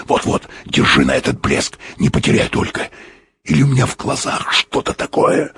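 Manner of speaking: hushed, mostly whispered urgency; urgent whisper. A narrator's reading. Explorer tells pilot to hold on the glint, whispering. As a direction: whisper the directions under your breath. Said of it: "Он говорил очень быстро и шёпотом".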